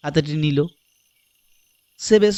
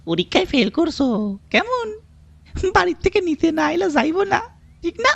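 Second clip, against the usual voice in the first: high-pitched, teasing peon voice; grinning peon. A narrator's reading. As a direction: tease the boy through a big grin. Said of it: "একগাল হেসে বলল". (একগাল হেসে খোঁচা দেওয়া গলা)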